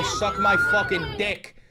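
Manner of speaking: high slow defiant shout; defiant yell. Retold or imitated. Streamer quoting a New Yorker defying the officers, defiant yell. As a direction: throw it in their face.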